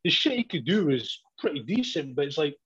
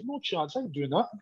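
ordinary talk

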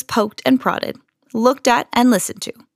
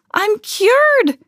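high-pitched exclamation of triumph; joyful shout; 12 semitones higher, 3 dB louder than usual. A narrator's reading. Camilla back to normal at last, shouting. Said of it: "she shouted".

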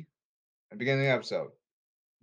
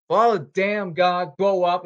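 exasperated outburst; 4 semitones higher, 7 dB louder than usual. Real, not acted. Ranting at Congress, exasperated.

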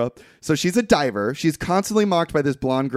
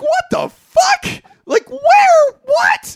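much higher, louder, slow disbelieving yell; a stunned, squealing "What the fuck?"; shocked outcry; 7 dB louder, much slower than usual. Retold or imitated. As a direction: shriek it in outraged disbelief. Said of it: "And I'm like".

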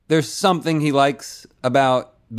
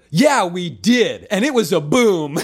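imitating Trump; 7 semitones higher than usual. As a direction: drag out a smug Trump brag. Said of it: "and then Trump would go".

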